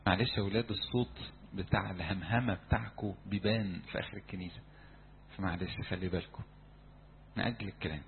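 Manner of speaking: drops voice to hush the church; soft aside to the congregation; quiet aside. Real, not acted. Asides to congregation about noise. (همس جانبي هادئ)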